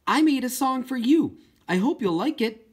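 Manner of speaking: excitedly addressing the whale's picture; eager and excited. Acted. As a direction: tell the whale your gift, thrilled. Girl speaks to whale picture.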